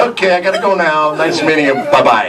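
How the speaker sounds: high, over-bright hasty farewell; mock-nervous goodbye. Acting his hasty, nervous exit.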